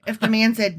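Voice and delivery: ordinary talk, nothing marked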